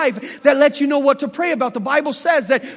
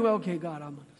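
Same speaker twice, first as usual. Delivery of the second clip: stoic muted thanks; 10 semitones lower, 12 dB quieter, much faster than usual. Retold or imitated. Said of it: "stand there so stoically".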